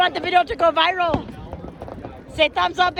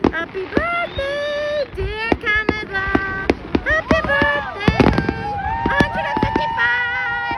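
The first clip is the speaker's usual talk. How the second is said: celebratory cheer; 6 semitones higher than usual. Real, not acted. Birthday cheer for Canada.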